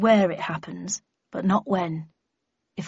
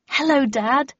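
bright, lifted greeting, higher and louder; brightly; 6 semitones higher, 5 dB louder than usual. A narrator's reading. Daughter greeting her time-travelling dad, brightly. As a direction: greet him with sunny cheer. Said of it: "I said brightly".

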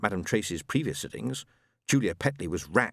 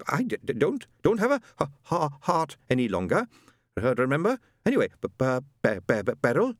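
stammering husband; 5 semitones higher than usual. Acted. Ron stammering.

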